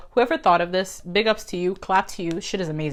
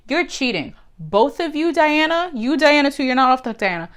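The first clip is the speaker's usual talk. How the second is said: raised, higher scolding call-out; scolding Diana and Arthur for cheating, raised voice; 6 semitones higher than usual. Real, not acted. Scolding the characters.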